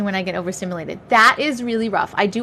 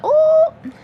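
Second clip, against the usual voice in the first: high playful ooh with a laugh; high teasing ooh; 4 dB louder than usual. Real, not acted. Reacting to a viewer's Honda, high teasing ooh. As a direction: squeal it teasingly.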